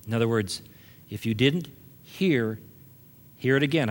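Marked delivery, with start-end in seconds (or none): none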